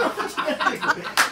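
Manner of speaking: bursting high laugh; laughing out loud. Real, not acted. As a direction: break into laughter. Anchor cracks up. (吹き出して笑う)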